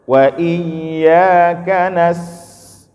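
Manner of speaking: Qur'an chant with "nas" flowing on unbroken; slow chanted "Wa iyyaka nas" with a held flowing s; flowing recitation. Shown as a demonstration. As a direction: draw the s of "nas" out smoothly, voice never stopping. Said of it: "alirkan suaranya".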